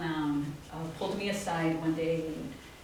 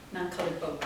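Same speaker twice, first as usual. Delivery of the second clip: ordinary talk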